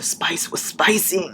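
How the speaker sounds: hushed, slow whisper. Real, not acted. Telling viewers about the spice in a whisper. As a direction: lean in and whisper it.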